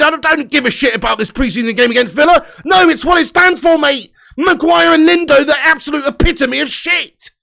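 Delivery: high and loud, hammering the point home; angry shout. Real, not acted. Fan telling viewers why he is annoyed, angry shout. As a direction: vent why it matters so much. Fan explains his anger.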